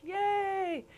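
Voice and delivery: high, playful sarcastic yay; mock cheer. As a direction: cheer it with a wink. Mock cheer about little research.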